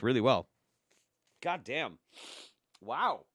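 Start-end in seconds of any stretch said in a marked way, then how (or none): none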